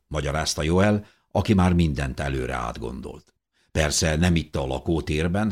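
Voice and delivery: usual speaking voice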